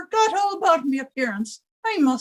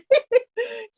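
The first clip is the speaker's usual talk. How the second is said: high, nervous little laugh; a quick titter, half afraid; nervous giggle; 7 semitones higher than usual. Acted. Landlady laughing nervously about being afraid of the dark, high giggle.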